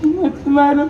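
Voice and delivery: crying, pained plea for mercy; tearful begging. Acted. Beaten doctor to attacker, sobbing plea. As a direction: beg through sobs, voice rising as the blows land.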